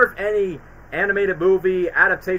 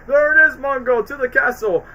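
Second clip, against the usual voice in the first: higher, louder rallying call; quoting the movie; 7 semitones higher, 3 dB louder than usual. Retold or imitated. Cheering Mumbo toward the castle, raised up. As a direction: cheer the giant on. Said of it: "Far Bucks".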